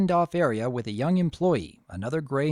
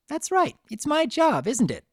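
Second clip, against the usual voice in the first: bubbly Pinkie voice; 12 semitones higher than usual. A narrator's reading.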